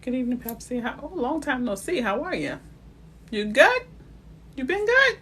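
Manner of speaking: bright, rising hello to a friend; excited greeting. Real, not acted. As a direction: greet them like a long-lost friend. Greeting a viewer in chat.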